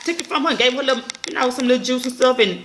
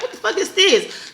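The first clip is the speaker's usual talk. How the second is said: high, slowed disgusted outburst; grossed out; 10 semitones higher, slower than usual. Retold or imitated. Viewer stumbling on a gross post, high and disgusted. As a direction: draw it out in disgust. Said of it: "You're like".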